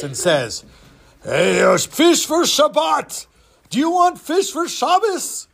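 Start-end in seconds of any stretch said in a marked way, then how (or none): 1.2-5.5 high put-on old man voice; disguised fishmonger voice. Don disguised as fishmonger hawks fish, changed voice. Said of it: "changes his voice".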